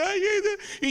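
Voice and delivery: put-on high whimpering child; crying child voice. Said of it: "la voz interna del niño". (quejido de niño que llora)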